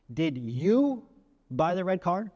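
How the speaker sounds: "you" drawn out and rising, then a pause; stress on YOU. Shown as a demonstration. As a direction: lean hard on "you", pause after it. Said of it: "in several ways with several different meanings".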